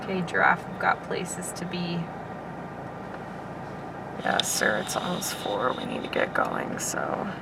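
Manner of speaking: soft hushed voice, coaxing an animal. Real, not acted. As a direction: quietly ask the giraffe to move. Quietly coaxing a giraffe on road.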